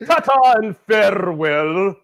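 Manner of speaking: slow, lightly posh sign-off; a touch of a gracious goodbye; mock farewell. Cohost echoes the drunk man's polite farewell. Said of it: "He signed off".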